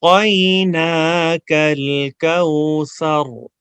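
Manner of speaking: slow melodic chant with pauses; recitation to repeat. Shown as a demonstration. Teacher leads students to repeat after him, slow chant. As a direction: recite for the class to echo back. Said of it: "میں پاز لوں گا".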